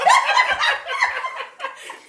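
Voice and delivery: loud high laugh. Real, not acted. Laughing at impression.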